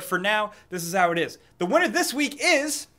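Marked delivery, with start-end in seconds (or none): 1.6-2.9 dramatic announcement. Pretend. Announcing giveaway winner.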